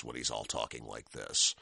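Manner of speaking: low monotone fake American accent; Hugh Laurie. Showing how the actor talks as House, low American accent. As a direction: imitate a British actor's put-on American accent, pitch dropped. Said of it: "Hugh Laurie doing House".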